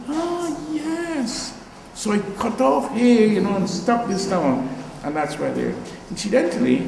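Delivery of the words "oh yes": higher, softer dawning oh yes; sudden realisation. Retold or imitated. Artist realizing the firewood design works, soft, high delight. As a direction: let the answer land as a quiet discovery. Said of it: "And I thought".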